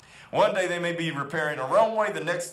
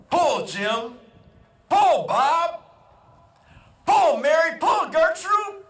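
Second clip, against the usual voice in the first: rising shouts, much higher and louder each name; farmer yelling; 12 semitones higher, 7 dB louder, slower than usual. Retold or imitated. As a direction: bellow each name to the mule, building up. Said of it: "he yells out".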